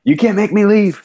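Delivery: high, loud playful protest; hammed-up yell of defiance; mock defiant shout. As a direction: shout it like refusing to be dragged out. Mock defiance at being removed.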